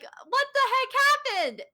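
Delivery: high-pitched, baffled exclamation; shocked outburst. Retold or imitated. Fan retelling a reaction to the video's ending, shocked. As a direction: blurt it out in high disbelief.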